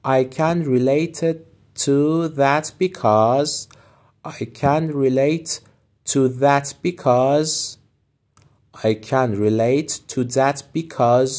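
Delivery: very slow, clear teacher read; slow model phrase. Shown as a demonstration. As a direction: stretch each word for learners.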